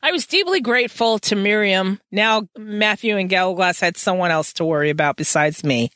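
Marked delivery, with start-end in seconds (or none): none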